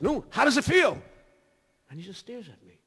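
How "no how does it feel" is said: raised voice. Retold or imitated. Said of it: "I asked him, I says".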